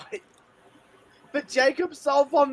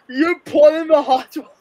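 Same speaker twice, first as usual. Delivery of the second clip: usual speaking voice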